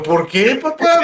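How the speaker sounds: high, surprised why; surprised question. Retold or imitated. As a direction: jump up in pitch, caught off guard. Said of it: "Y yo". (pregunta sorprendida, aguda)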